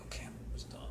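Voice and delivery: whispered, breathless self-reassurance; whispered inner thought. Retold or imitated. Worn-out runner nearing the end, whispering to himself.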